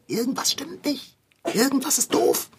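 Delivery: hushed, uneasy whisper; whispered suspicion. Acted. Boy whispers to Conny about Bärbel, uneasy.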